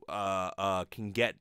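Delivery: usual speaking voice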